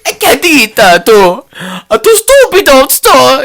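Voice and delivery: high, loud mock Italian rant; angry Italian grandpa. Mocking the grandfather from the show, loud Italian rant. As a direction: rant like an outraged old Italian. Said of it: "He's like".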